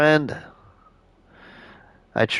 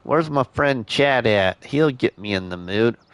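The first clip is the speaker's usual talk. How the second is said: normal talking voice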